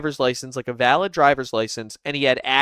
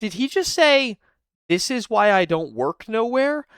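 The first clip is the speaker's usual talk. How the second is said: incredulous; 7 semitones higher than usual. Real, not acted. Incredulous reaction to clip.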